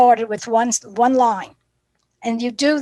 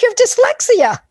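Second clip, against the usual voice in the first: much higher and louder, excited; excited reveal; 12 semitones higher, 7 dB louder than usual. Retold or imitated. Teacher telling the boy the news, excited. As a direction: announce it with delight. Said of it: "I said".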